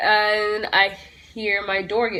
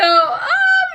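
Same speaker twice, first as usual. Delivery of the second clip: high yelp; 4 dB louder than usual. Real, not acted. Sudden yelp.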